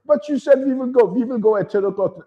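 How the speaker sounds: high, whiny mock protest; insistent German girl. German girl reminding him of the plan, high and whiny. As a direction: plead the rule back like a fussy girl. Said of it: "German girl insists".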